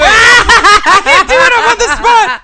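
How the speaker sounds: squealing with laughter, high and loud; laughing high. Real, not acted.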